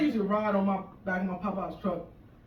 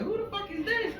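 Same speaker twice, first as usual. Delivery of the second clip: put-on high, startled girl demanding answers; the date asking who the wife is, high and startled; confused date. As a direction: ask who this woman is, pitched up. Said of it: "girl's like".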